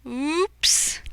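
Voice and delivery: high surprised oops; playful alarm; comic oops. Acted. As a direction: say oops like a cartoon slip. Alarm moment.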